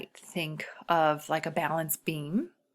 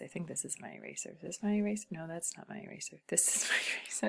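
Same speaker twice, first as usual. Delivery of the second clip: ordinary talk, nothing marked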